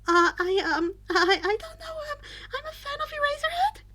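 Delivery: high, panicky stammer; nervous boy stammering an answer to his classmate; flustered stammer. Acted. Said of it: "Izuku hissed back".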